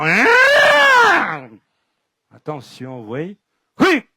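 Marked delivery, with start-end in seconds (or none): 0.0-1.5 huge high shouted roar; mean roar. Acted. Storyteller turns very mean, roaring. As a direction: let out a wild roar like a furious beast. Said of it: "je deviens très, très méchant".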